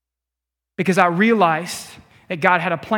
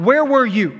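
raised, reproachful voice, higher and a bit louder; angry; 5 semitones higher, 4 dB louder than usual. Acted. Martha accusing Jesus of arriving too late, angry. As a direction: throw the blame at him. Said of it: "And she's angry".

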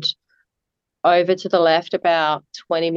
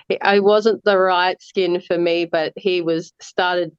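ordinary talk, nothing marked

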